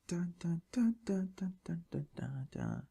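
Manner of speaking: soft, whispered dun-dun-dun; mock drum roll. Host builds up to the title reveal, hushed drum roll. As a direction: tap out a hushed suspense build.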